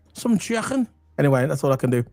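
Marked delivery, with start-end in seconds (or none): 0.1-0.9 put-on Scouse accent. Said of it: "can you do a Scouse accent?".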